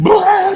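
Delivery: sudden high loud blubbering wail; a loud, high, comic cry breaking off the promo; mock wail. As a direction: burst out in a hammed-up sob. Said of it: "just starts crying".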